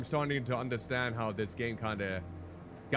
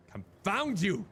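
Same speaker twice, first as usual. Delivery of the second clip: theatrical frustrated cry; exasperated outburst; 8 semitones higher than usual. Real, not acted. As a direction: curse it out in exasperation. Stuck puzzle piece won't fit.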